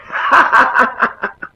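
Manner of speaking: confident laugh. Acted. Young warrior answering the emperor's question, confident performed laugh. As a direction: laugh it out in a run of separate ha-has.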